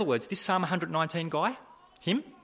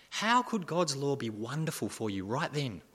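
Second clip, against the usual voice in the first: normal talking voice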